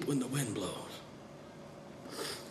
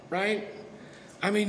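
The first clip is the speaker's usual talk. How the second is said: ordinary talk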